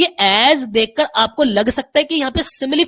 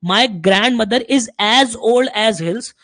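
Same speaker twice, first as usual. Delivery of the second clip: slow example sentence; 4 dB louder, much slower than usual. Shown as a demonstration. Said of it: "अगर मैं पूरी statement देख रहा हूं".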